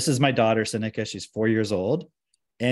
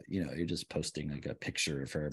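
low, muted sceptic's voice; imagined critic, much lower and quieter; imitated doubter; 8 semitones lower, 11 dB quieter than usual. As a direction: drop low and soft, as a dismissive naysayer. Voicing an imagined online doubter.

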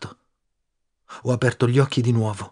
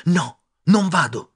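slow, loud, higher "non vado", stressed on "non"; stubborn refusal; 6 semitones higher, 4 dB louder, slower than usual. Acted. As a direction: dig in, refusing. Friend refuses to leave.

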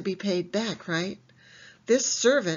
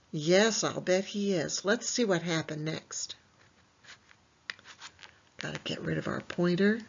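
ordinary talk, nothing marked

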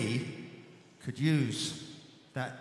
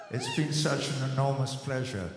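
ordinary delivery

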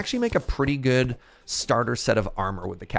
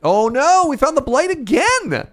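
high, loud cry of alarm; alarmed shout; 13 semitones higher, 8 dB louder, slower than usual. Real, not acted. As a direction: yell it in sudden panic. Blight attack, sudden alarm.

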